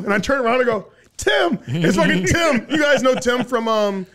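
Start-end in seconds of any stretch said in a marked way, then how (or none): 1.2-2.7 laughing shout. Retold or imitated. Retelling laughing introduction.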